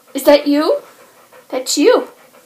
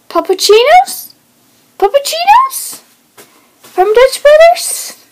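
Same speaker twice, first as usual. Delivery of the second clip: much higher, squeaky coaxing; 13 semitones higher, 4 dB louder than usual. Real, not acted. Owner exciting her dog about puppuccino treats, squeaky high baby talk.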